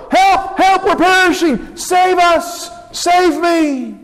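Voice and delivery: high, frantic calls for help; panicked cry. Acted. Frightened disciples in the storm, high panicked pleas. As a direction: cry out like the boat is going under.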